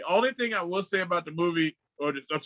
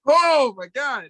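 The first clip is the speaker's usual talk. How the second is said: much higher and louder, drawn out slowly; stunned exclamation; 8 semitones higher, 8 dB louder, much slower than usual. Real, not acted. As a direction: stretch it out in disbelief. Reacting to horrifying scene.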